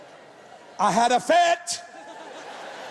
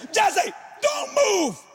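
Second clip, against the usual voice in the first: wife's warning; 8 semitones higher, much slower than usual. Retold or imitated. Wife spots snake.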